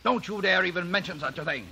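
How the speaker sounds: enraged father; furious scolding. Acted. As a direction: forbid it outright. Father forbids revenge.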